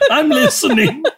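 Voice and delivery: laughing. Real, not acted. Laughing while choking on snack.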